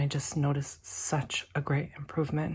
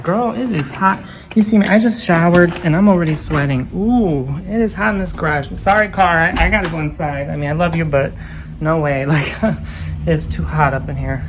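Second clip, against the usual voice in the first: loud; 9 dB louder than usual. Real, not acted. Complaining in hot garage.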